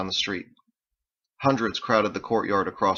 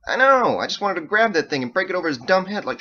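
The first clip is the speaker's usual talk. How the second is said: loud, high, fast rant; heated venting; 11 semitones higher, 7 dB louder than usual. A narrator's reading. Ditch agrees about the annoying cellist, heated. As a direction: rush through it, worked up.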